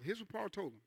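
usual speaking voice

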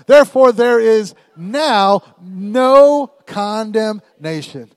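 very loud and slow, "now" and "no" stretched out; emphatic. Shown as a demonstration. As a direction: belt it, stamping on "now". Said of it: "When you get emphatic".

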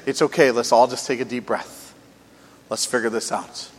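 soft, soothing reassurance; calm peacemaker easing a tense crowd. Pretend. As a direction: talk a room down gently. Voicing a calming peacemaker.

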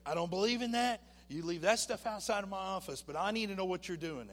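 the doctor rejecting his faith healing; angry doctor. Retold or imitated. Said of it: "And he was mad".

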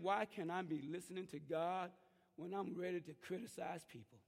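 normal talking voice

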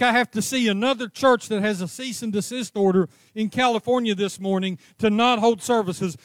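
ordinary delivery

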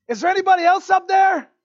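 very high, loud comic holler; shouting up. Acted. Jack refusing to let go, calling for anybody else, shouting. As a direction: holler past God for another rescuer.

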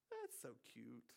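soft sarcastic coo. Acted. God belittling the rebels' fight, softly sarcastic. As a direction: condescend softly, as if to someone harmless. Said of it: "That's sarcasm".

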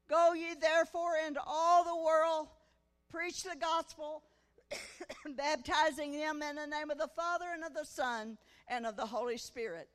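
loud, ringing scripture quote, preached out; preaching scripture. Retold or imitated. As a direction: proclaim it like a revival preacher. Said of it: "I could hear myself quoting".

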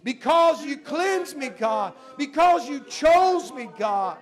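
loud, high, full-force praying aloud; impassioned prayer. Real, not acted. Preacher thanking God before the congregation, impassioned prayer. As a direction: cry out to God, drawing out the key words.

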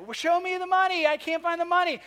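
playful hammed-up movie quote; Jerry Maguire quote. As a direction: belt it like the famous film line. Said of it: "quoting that line from Jerry Maguire".

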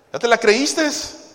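normal talking voice

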